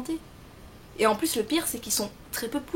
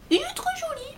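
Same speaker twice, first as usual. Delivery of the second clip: very high-pitched gushing squeal; delighted squeal. Real, not acted. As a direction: squeal with delight at how pretty it is. S'extasie devant une couleur de fard. (cri aigu d'émerveillement)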